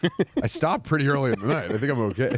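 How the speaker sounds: lightly voiced busted driver; mock sheepish driver. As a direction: play the guilty driver, laughing. Plays the pulled-over driver.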